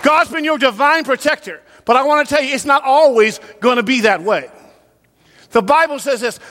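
usual speaking voice

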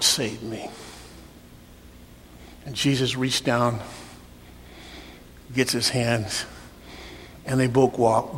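soft, slowed retelling; hushed storytelling. Real, not acted. Pastor softly retelling Jesus rescuing Peter, hushed. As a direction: draw them in quietly.

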